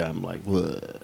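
drained, let it sag out; worn out. Real, not acted. Tired cohost shows how his body feels, limp. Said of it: "But physically".

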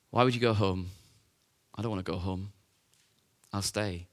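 low, soft, subdued refusal; a touch of grief, voice dropping away; understated sorrow. Acted. Grieving Mary refusing to leave the tomb, quietly sad.

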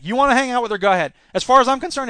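high, loud, fed-up challenge; angry dismissal. Acted. Susan washing her hands of Karen, angry. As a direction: dare them to go, loudly.

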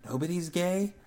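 small, soft, puzzled mousy question; timid squeak of a doubt. A narrator's reading. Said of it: "Michelle's mousy voice say".

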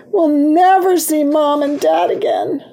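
high, acted tearful wail; sobbing. A narrator's reading. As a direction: wail it as if starting to sob. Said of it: "Virginia began to sob".

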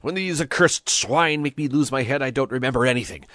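flustered, loud exasperation; exclaimed. A narrator's reading. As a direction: blurt out an irritated excuse. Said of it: "exclaimed Pepone".

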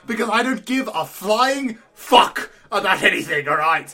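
loud, hammed-up villain rant; mock-villain outburst; indignant Joker. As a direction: rant loudly as a comic Joker. Joker rants at pizza man.

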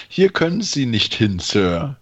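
ordinary talk

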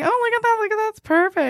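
high-pitched mimicking gush; mock excitement. Host teasing co-host about her reaction, mock excited.

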